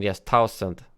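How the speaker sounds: ordinary delivery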